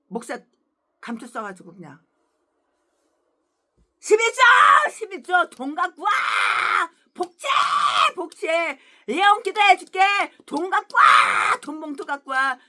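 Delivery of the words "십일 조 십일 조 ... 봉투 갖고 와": loud barked demands for money; mock greedy shouting. Greedy pastor shouting at believers for tithes, mock yell.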